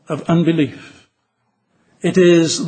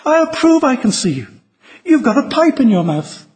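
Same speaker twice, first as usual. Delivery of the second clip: high, insistent storybook heroine; acted earnest persuasion, pitched up; eager insistence; 7 semitones higher than usual. A narrator's reading. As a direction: prove the point in a raised pitch. Lucy sets out her proof.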